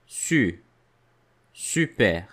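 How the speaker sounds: Su then Super with rounded u; teacher models Su and Super; syllable build-up. Shown as a demonstration. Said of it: "in the word super".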